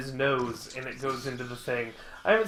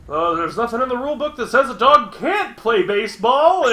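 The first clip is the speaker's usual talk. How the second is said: higher, louder smug official voice; mock commissioner; 6 semitones higher, 5 dB louder than usual. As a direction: play the commissioner reading the rule book. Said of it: "And he's just like".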